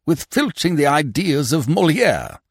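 high, loud indignant outburst; stung indignation. A narrator's reading. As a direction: throw the accusation back, offended. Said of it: "He had been touched on the raw".

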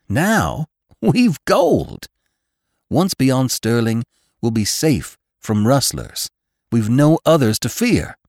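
elated, high ringing voice. A narrator's reading. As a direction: burst out with newfound hope. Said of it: "his voice ringing high and sweet".

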